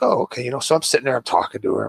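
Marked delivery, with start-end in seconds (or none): 0.0-0.4 pleased surprise. Retold or imitated. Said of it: "And I was like".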